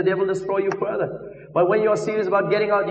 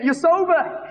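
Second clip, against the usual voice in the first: excited; 9 semitones higher, slower than usual. Retold or imitated. Evangelist to the sobered drinker, excited. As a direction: exclaim it high, amazed at the change. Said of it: "I got, I got excited".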